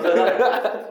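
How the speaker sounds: giggly high question; laughing. Real, not acted. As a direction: ask it through real laughter. Still laughing at joke.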